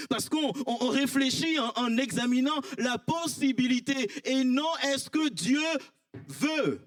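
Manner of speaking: fiery preaching. Acted. Pastor rousing the congregation at full force.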